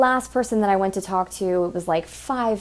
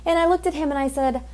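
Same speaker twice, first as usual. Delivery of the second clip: ordinary talk, nothing marked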